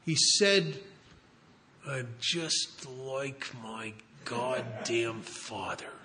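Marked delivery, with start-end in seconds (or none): 1.9-5.9 slow, choked whisper, far quieter and lower. Retold or imitated. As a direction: force each word out through a tight throat, barely audible. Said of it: "this choked whisper as if out of some sort of abyss".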